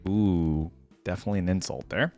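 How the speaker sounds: normal talking voice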